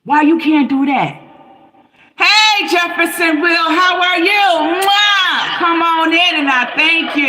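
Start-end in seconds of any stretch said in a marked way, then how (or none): 2.2-7.3 effusive greeting. Acted. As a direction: call out a big drawn-out welcome with a kiss.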